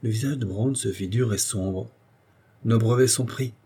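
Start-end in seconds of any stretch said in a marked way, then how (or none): none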